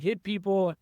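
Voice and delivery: usual speaking voice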